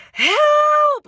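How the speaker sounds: high, loud cry of help; panicked scream for help; retold scream for help. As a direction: yell it like your life depends on it. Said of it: "I was literally screaming".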